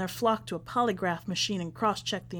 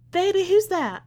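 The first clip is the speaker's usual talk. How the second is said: high, louder call from back in the house; calling from the foyer; 10 semitones higher, 5 dB louder than usual. Acted. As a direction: call out from another room. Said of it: "Her voice echoes in the foyer".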